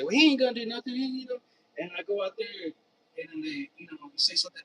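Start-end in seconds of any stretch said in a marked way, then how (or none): 0.0-1.4 imitating a scoffing neighbor, higher and louder; mock taunt. Imitating the neighbor who scoffs at him, louder taunt. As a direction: mimic the doubter's scoff, higher. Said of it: "and they'll say".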